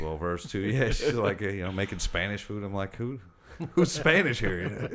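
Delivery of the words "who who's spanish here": high, loud mock disbelief; teasing surprised squeal; mock surprise. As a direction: play up the fake shock at his mom. Retelling teasing his mom.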